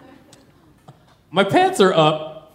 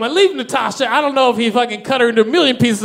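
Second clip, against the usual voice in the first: ordinary talk, nothing marked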